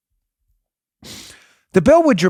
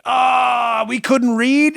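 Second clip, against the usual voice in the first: mock excuse; 7 semitones higher, 3 dB louder, much slower than usual. Mocking officials' excuse.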